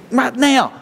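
high, insistent demand; mock-impatient, pitched right up. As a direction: insist like someone ticked off at waiting. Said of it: "kinda ticked off at Him".

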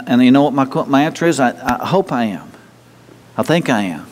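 normal talking voice

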